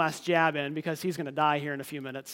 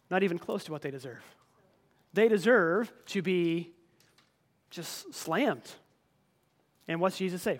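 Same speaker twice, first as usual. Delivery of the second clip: ordinary talk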